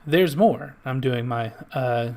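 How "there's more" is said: loud, high TV pitchman hype; infomercial voice. Pretend. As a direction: sell it like a late-night ad. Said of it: "my best, you know, infomercial voice".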